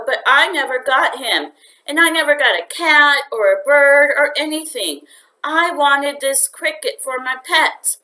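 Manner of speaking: lightly voiced eager boy. A narrator's reading. Boy begging his mother to keep the cricket, eager. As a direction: talk fast to win the argument. Mario complains to his parents.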